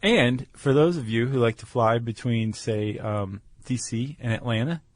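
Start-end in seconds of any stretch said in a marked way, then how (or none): none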